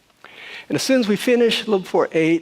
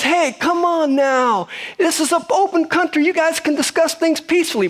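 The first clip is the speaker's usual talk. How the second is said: much higher and louder, a mock shout for calm; imitating a loud plea; 13 semitones higher, 3 dB louder than usual. A man in the corner shouting at the arguers to calm down, mock yell. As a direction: play the peacemaker yelling over everyone. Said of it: "but he says it really loud".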